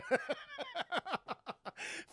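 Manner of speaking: long, high wheezing laugh. Real, not acted. Streamer cracking up at Raiden's reaction, long high laugh. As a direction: laugh helplessly in high bursts.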